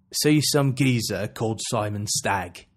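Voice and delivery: slow, put-on Cockney as Penguin; Cockney accent. Gamer imitating Penguin's line in a Cockney accent. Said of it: "does the voice of Penguin in a Cockney accent".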